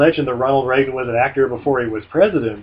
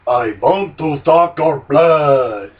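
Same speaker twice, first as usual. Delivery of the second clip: slow, drawn-out Transylvanian vampire; 5 dB louder, much slower than usual. Acted. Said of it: "Pretend like you're Dracula".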